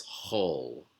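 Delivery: "hol" slowly, o vowel, h not ch; slow demo sound. Shown as a demonstration. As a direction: hold out "hol" for learners. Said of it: "pronounced hol instead of chal".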